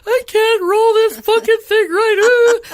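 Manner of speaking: high, loud, slow laughing sob; laugh-crying. Retold or imitated. Baking buns, frustrated, a loud laughing cry. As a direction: wail it through laughter and tears. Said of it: "'cause I was like".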